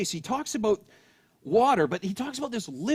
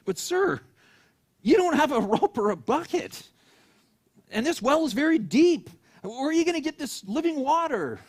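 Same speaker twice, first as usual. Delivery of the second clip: puzzled woman; 7 semitones higher, 4 dB louder than usual. Acted. Woman questions Jesus.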